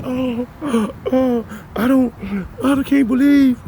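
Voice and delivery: mock pretend disbelief. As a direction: parody their fake shock, climbing into a loud squeal. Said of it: "They can pretend".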